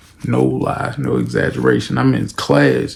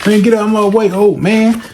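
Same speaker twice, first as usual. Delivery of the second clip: higher, dismissive brush-off; rude young thug; 8 semitones higher than usual. Retold or imitated. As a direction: push past him. Gang brothers insulting officer.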